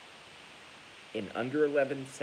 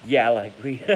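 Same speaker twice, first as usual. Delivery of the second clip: laughing loud; 12 dB louder than usual. Real, not acted. Reading a comment, laughing loudly.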